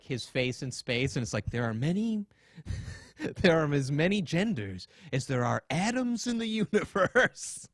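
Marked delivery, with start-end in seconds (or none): 1.5-7.7 mocking quote. Mock quote of a speaker.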